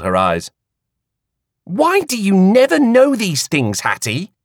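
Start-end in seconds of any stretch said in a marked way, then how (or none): 1.6-4.3 exasperated. A narrator's reading. Queenie exasperated with clueless Hattie. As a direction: roll the eyes audibly.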